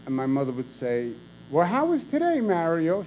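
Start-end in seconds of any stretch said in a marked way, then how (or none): 1.5-3.0 bright lilting greeting, much higher on well; mock cheery. Mother greeting husband home after campaigning, cheery. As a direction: sing it out like a welcoming wife. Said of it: "She'd be all cheery".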